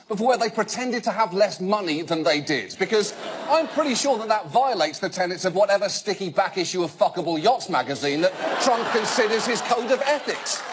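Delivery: ordinary delivery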